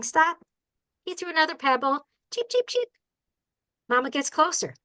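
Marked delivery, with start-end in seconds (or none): 2.3-2.9 high little chick peeps; baby bird cheeps. Pretend. Chicks answering the pebble, high cheeping. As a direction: chirp like tiny nestlings. Baby birds chirping again.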